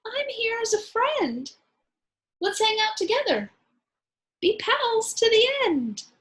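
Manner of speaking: high, friendly little mouse voice; mouse peeping. Acted. The mouse reassures the scared lion in a high peep. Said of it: "Mouse peeped".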